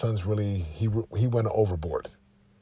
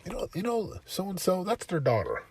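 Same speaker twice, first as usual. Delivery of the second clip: soft fatherly talk; 6 semitones higher, 4 dB quieter than usual. Retold or imitated. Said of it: "I said".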